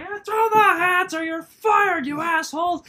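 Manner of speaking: high, drawn-out shouted threat; loud and slow, easing at the end; angry boss yell. Retold or imitated. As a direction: imitate a boss hollering at his staff. Boss yelling threats, retold.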